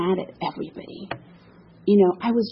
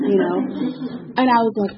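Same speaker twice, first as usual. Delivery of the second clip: normal talking voice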